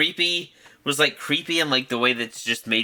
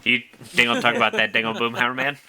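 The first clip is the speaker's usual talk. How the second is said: Boomhauer; 3 dB louder than usual. Retold or imitated. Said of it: "Yeah, Boomhauer".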